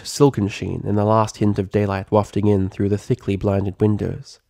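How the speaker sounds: usual speaking voice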